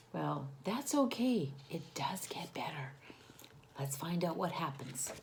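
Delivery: soft reassuring whisper. Real, not acted.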